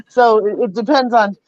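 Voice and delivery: ordinary delivery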